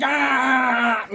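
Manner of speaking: high, drawn-out scream; high "aaah" scream on waking. Acted. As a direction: scream awake, long and high. Acting himself waking up screaming.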